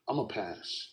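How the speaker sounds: soft, low, unbothered; cool confidence. Retold or imitated. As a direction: shrug it off coolly. Cocky calm reply.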